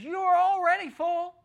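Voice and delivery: high, loud mocking sarcasm; sarcastic. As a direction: lay the sarcasm on thick, voice up high. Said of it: "he begins to use that sarcastic language".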